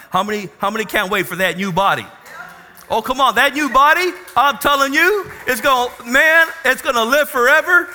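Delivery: loud, high, fast preaching; rally the congregation about the new body; full-throated sermon climax; preaching at full force. Acted.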